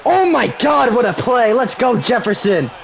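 high, thrilled outburst; excited celebration. Real, not acted. Gamer celebrating his receiver's big play, excited. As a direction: cheer on a huge play.

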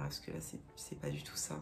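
aparté chuchoté, dépité; murmure pour soi. Real, not acted. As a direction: se le dire tout bas à soi-même. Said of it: "chuchote pour elle-même". (chuchotement découragé sur son accent)

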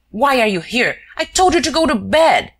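high, loud angry scolding. Acted. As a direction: hammer the orders home. Shears scolding Wilson.

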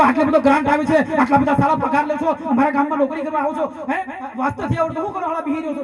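acted accusing complaint; confrontational asking. Shown as a demonstration. Villager takes teacher to task over pupil's reading, mock accusing. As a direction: demand answers, piling up the charges. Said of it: "પણ તમે એમ કહો".

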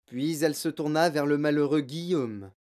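ordinary delivery

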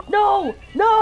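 high, loud cry of protest; distressed cry. Real, not acted. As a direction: cry out as it all goes wrong. Cube about to be destroyed.

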